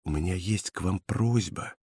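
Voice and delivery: very soft, discreet request. A narrator's reading. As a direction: drop the voice right down. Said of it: "понижая голос".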